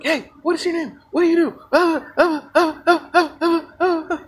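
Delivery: high-pitched pestering mock voice; mosquito-like pest. Eager guy pestering a girl at dinner, high mock mosquito voice. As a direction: buzz away like a mosquito, high and grating. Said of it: "buzzes in her ear like a mosquito".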